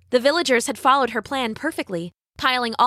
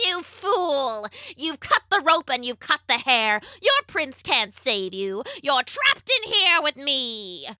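high witch voice slowing into menace; wicked, drawn-out gloating; 4 semitones higher than usual. A narrator's reading. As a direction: taunt your trapped victim, stretching the last words. Witch gloats over trapped Rapunzel.

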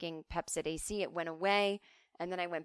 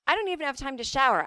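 fast, louder, higher mom complaint; mocking busy mom; 6 semitones higher, 7 dB louder, faster than usual. Mocking the moms who wrote in, a fast loud harried complaint. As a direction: rattle it off like a swamped mom. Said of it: "when you guys would be like".

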